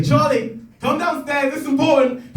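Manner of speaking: high urgent call up the stairs; calling a housemate. Retold or imitated. Calling the housemate down the stairs, high and urgent. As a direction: summon him like it's an emergency. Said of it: "I say".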